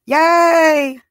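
high, loud little cheer; excited cheer. Real, not acted. Cheering at romantic chat.

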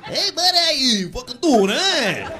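muddled mix of Alabama and Brooklyn accents. Shown as a demonstration. Guy unsure where he's from greeting buddies, Alabama-Brooklyn accent. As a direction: waver between Brooklyn and Alabama accents, climbing high. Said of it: "doesn't know if he's from Alabama or Brooklyn".

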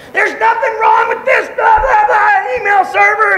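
high, loud mocking rant of a furious coworker; mock fit. Retelling a raging coworker's fit to the church, mock shouted. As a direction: throw a hammed-up tantrum. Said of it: "He just throw fits".

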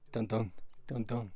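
mock dramatic heartbeat hum; heartbeat sound effect. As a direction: thump out a tense heartbeat. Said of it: "Tiger heartbeat in the background".

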